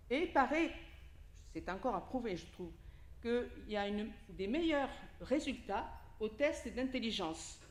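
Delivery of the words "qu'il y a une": normal talking voice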